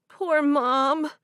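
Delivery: high, loud, near tears; tearful pity. A narrator's reading. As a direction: grieve for her mother. Crying over mother's loss.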